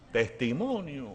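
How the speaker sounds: soft, sceptical echo of a word; incredulous echo. Retold or imitated. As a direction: repeat it quietly in mock disbelief. Pastor's incredulous inner echo of request.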